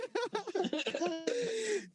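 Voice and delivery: high burst of laughter. Real, not acted. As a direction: crack up. Laughing at friend's remark.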